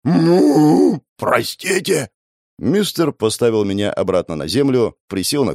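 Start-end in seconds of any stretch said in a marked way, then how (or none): 0.0-2.1 apologetic monster voice. Acted. Cow apologizes.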